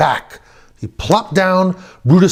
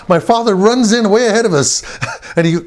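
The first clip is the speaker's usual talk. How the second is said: ordinary talk, nothing marked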